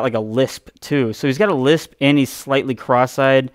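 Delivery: ordinary talk, nothing marked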